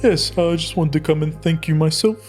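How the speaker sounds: ordinary delivery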